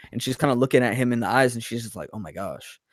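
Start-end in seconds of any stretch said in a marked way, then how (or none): 2.1-2.8 low, very soft murmur of dismay; hushed shock. Retold or imitated. Heroine looks the killer in the eyes, hushed shock. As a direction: say it under your breath, stunned. Heroine's stunned reaction, retold.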